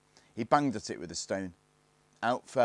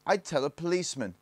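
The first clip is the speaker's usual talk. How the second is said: higher, louder, firm retort; stout; 9 semitones higher, 6 dB louder than usual. A narrator's reading. Barney answers the gang's threats, stoutly. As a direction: stand up to them stoutly. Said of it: "said Barney stoutly".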